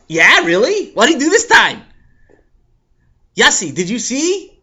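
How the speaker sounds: eager schoolboy, loud and high; loud excited kid. Acted. Boy rushing over, loud and eager. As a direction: burst in loud and keen. Avremel bursts in loudly.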